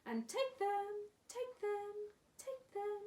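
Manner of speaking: high slow echo, fading. Acted. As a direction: call it like a cave echo dying away. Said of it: "came back the welcome sound".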